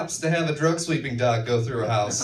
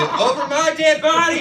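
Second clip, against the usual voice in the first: high, loud mock shout of a parent; 12 semitones higher, 4 dB louder than usual. Mock parent shout to child.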